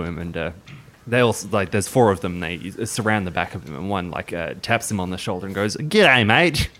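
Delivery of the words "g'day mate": high, loud broad Australian soldier; bad Aussie accent. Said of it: "that is hands down the worst Aussie accent".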